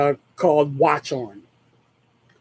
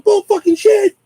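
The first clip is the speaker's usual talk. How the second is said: retold outburst; 5 dB louder than usual. Retold yelling back at mom.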